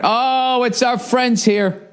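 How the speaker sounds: high, drawn-out silly welcome; mock-delighted greeting. Host greeting the sponsors, high and mock-delighted. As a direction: greet them in a goofy high voice. Put-on greeting before advertising read.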